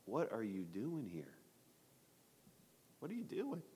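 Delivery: soft, hushed voice of God asking gently; small voice. Acted. God speaking to the frightened Elijah in a small, soft voice. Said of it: "He came in a small voice".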